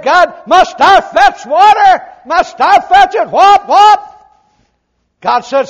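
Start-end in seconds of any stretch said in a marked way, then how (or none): 0.0-4.1 loud, high, slow angry complaint; frustrated Moses shouting at God; Moses' exasperated shout. Retold or imitated. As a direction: bark it out fed up, then mimic the strikes. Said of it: "Who said".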